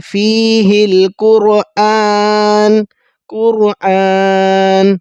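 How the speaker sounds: القرآن very slow, the ق full and heavy; heavy qaf. Shown as a demonstration. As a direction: recite قرآن with a thick, heavy ق. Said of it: "قوف پر کر کے پڑھیں گے". (ق پر کر کے، موٹا)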